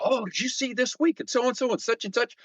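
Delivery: high, eager gossip about shows; excited TV chatter. Retold or imitated. Mimicking himself gushing about TV shows, high and eager. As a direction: gush about this week's episode. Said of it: "I was watching 'em all".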